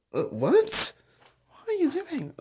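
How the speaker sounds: indignant startled question; startled confusion. Retold or imitated. Asking the man kicking the sofa what he is doing, high and indignant. Confronting the chair banger.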